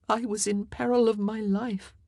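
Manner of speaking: plain reading voice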